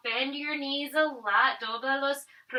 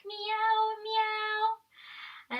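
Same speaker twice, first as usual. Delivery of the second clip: high playful kitty meow; cat meow; 9 semitones higher than usual. Shown as a demonstration. Teacher meowing high for kids in cat pose. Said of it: "What sound does a cat make?".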